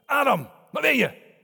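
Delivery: loud, high accusing call; reproachful shout. Shown as a demonstration. Preacher demos God calling in reproach. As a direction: bellow the name like a scolding. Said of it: "het niet klonk als volgt".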